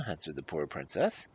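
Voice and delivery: usual speaking voice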